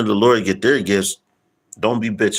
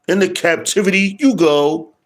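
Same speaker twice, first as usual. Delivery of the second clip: mock sentence; 11 semitones higher, 4 dB louder than usual. Repeat, raised and mocking.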